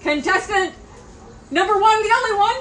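high, loud contestant call, building; game show announcer. Acted. Host summons the lone animal contestant, announcer shout. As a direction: build to a long-drawn final shout.

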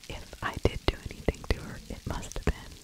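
normal talking voice

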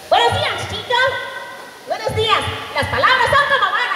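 high, stiff small man's voice; David Nelson voice. Retold or imitated. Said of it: "Entra y dice". (voz aguda de hombrecito)

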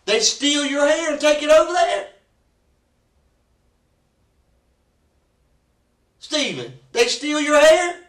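high, loud mocking questions; detective mocking the suspect's excuse; sarcastic disbelief. Real, not acted. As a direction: scoff at his story. Interrogator mocking the denial.

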